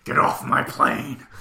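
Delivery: slow, put-on action-hero voice; drawn-out impersonation of a movie star giving orders; Harrison Ford impersonation. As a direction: growl it slowly like a film hero. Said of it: "that's my Harrison Ford impersonation".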